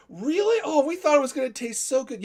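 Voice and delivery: high-pitched, put-on disbelief; feigned surprise. Pranksters pretending they expected it good, feigned surprise. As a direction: act innocent and astonished.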